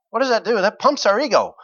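ordinary delivery

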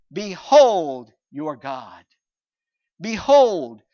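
loud, slow "Behold"; ringing pulpit proclamation; proclaiming. Acted. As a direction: proclaim "Behold your God" out.

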